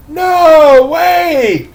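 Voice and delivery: high, loud gasping outburst; old man gasping in alarm; gasped cry. A narrator's reading. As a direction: gasp it out in horror. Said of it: "gasped Mister Crabtree".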